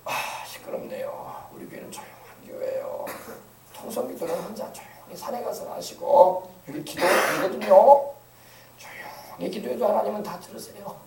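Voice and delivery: mock prim hush. Pastor imagines hushing congregation.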